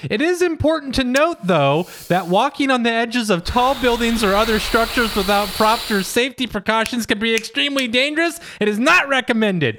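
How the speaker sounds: high, prim chatbot voice; robotic AI voice. Retold or imitated. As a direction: read it like a prissy AI assistant. Reading out the AI's answer.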